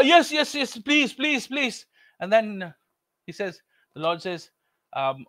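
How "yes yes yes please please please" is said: eager pleading. Acted. Jack eager begging.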